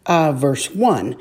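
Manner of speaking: usual speaking voice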